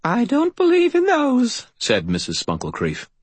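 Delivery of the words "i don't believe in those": put-on high, slow old woman's voice; firm old lady conviction; old landlady. Landlady answers the knight, high and slow.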